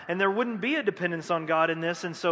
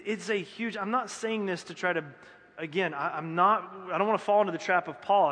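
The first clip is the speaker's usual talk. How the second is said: ordinary talk, nothing marked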